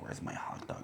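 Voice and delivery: hushed, whispered question; barely audible mock drowsy plea; imitated sleepy child. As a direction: whisper it like a kid half awake. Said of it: "he's sleeping, and then he just goes".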